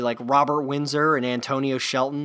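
ordinary talk

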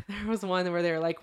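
normal talking voice

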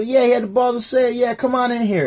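high, quick group cheer; rallying approval. Acted. Group takes in the guy who told a joke, rallying approval. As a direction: rally round him, high and fast.

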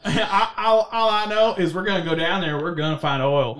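hammed-up Southern oilman; mock Southern twang, playing it big; Southern accent. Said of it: "I don't know why they got Southern".

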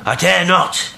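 high, loud refusal with a touch of longing; stifled craving. A narrator's reading. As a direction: call back a refusal, holding longing in. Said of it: "a note of stifled craving".

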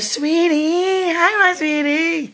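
tender high-pitched coo; cooing sweetly. Real, not acted. Cooing at the viewer.

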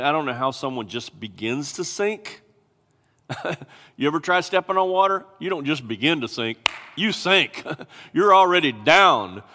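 ordinary talk

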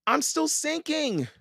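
very high panicked wail. A narrator's reading. Annie sinking in mud to Jack, wailing. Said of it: "Annie wailed".

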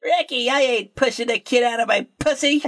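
high, whiny sitcom wife; Lucille Ball impression. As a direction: whine at Ricky like Lucy. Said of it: "She's like".

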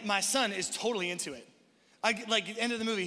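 usual speaking voice

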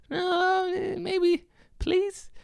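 very high, meek and pleading; squeaky begging. Pretend. Comic squeaky voice begging cops for mercy. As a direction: beg in a tiny squeaky voice. Timid plea voiced again.

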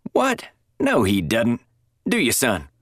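colonel scoffs, pitched high, brushing it off; lightly voiced gruff colonel waving it away; dismissive colonel. A narrator's reading.

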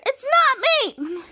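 high, drawn-out acted outcry; indignant protest. Mika denies being possessed, indignant protest. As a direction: protest slowly at a high pitch.

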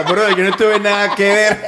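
high, slow, laughing grandma voice; grandmother in heaven. Pretend. Grandma up in heaven denying she saved him, high and laughing. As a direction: play the dead grandma, protesting innocence with a laugh. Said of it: "Y la abuelita por allá arriba".

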